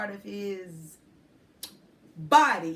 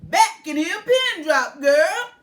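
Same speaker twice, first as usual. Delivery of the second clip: louder teasing man; man explaining; 5 semitones higher, 12 dB louder than usual. A narrator's reading. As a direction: tease her kindly. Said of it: "he explains".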